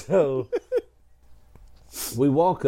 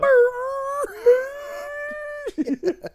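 mock kid wail. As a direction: bawl like a howling child, overplayed. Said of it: "Why is it that kids cry like that?".

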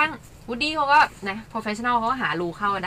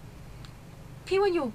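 1 doubtful interpreter; 9 semitones higher, 7 dB quieter, much slower than usual. Retold or imitated. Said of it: "เขาก็แบบ".

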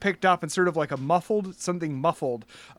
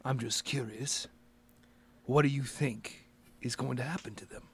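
soft, probing menace; lightly voiced boss, low and quiet, probing; Mister Holder; 4 semitones lower, 10 dB quieter than usual. Boss asks the caller what will happen, quiet menace.

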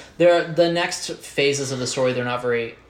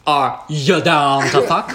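mock come-on; 2 semitones higher, 6 dB louder, slower than usual. Mock translation of yada.

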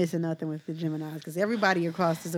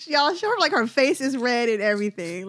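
laughing; 3 semitones higher, 6 dB louder than usual. Real, not acted. Laughing at cohost.

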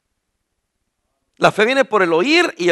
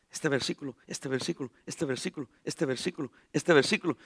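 rapid verse listing; 6 semitones lower, 16 dB quieter than usual. Retold or imitated. Rattling verses at invitation.

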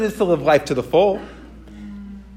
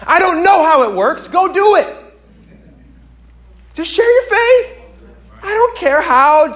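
much higher and louder, nearly shouting; urgent preaching; 6 semitones higher, 5 dB louder than usual. Acted. Urging the church to share their faith now, urgent preaching. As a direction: drive them out the door to act.

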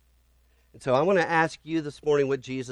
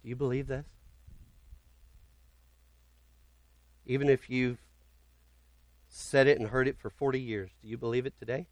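hushed question; 6 dB quieter, faster than usual. Real, not acted. Preacher drops to near whisper.

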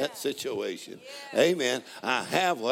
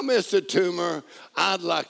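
loud proclaiming; 7 semitones higher, 5 dB louder than usual. Acted. Introducing the tumor to God.

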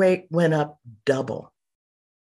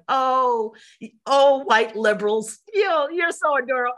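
higher-pitched, patronizing coo; mock-adoring; 6 semitones higher than usual. Mocking quote of herself gushing at audience.

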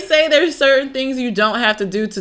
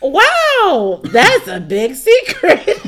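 high-pitched, drawn-out forced politeness, half laughing; faking cool; 7 semitones higher, much slower than usual. Acted. Listener trying not to offend him, badly faking cool. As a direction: act impressed while barely holding in laughter. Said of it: "You gotta act like you're cool".

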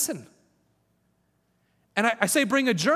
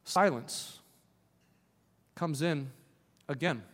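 softer and lower with each word until almost nothing; preacher hushing down to near silence; fading to a hush; 7 semitones lower, 8 dB quieter than usual. Acted. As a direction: let the voice sink into the stillness it describes. Describes still quiet silence.

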